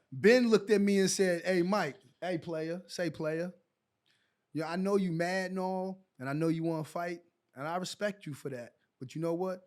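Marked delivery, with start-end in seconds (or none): 1.5-9.6 low, soft, sinking almost to a murmur; imitated calm. Teammate talking down an angry naked player, imitated calm. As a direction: talk him down quietly, lower and softer as you go. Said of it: "he said it so calm".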